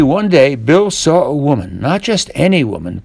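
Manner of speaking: plain reading voice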